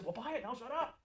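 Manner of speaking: retelling the quip to a trainee, mildly playful; joking bluntness. Retold or imitated. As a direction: say "buy it, now shut up" as a half-hearted joke. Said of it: "they would kinda laugh nervously".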